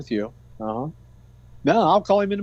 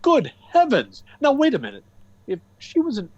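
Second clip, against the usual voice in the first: startled, pitch jumping much higher; sudden alarm, higher and quicker at the end; surprised; 8 semitones higher, 3 dB louder, faster than usual. Acted. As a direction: caught off guard, voice shooting up. Boss startled by a revelation.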